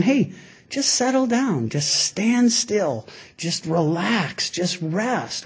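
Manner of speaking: soft, slowed, easing down; gently soothing. Retold or imitated. Preacher voices the Lord telling us to rest, soothingly. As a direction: settle them with a hushed, unhurried voice.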